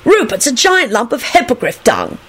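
high, insistent insult; stubborn. A narrator's reading. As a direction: declare it stubbornly. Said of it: "he told Alex stubbornly".

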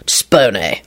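ordinary delivery

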